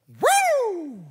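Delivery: high, loud imitated siren wail; police siren. Preacher imitating the cop siren behind him, high loud wail.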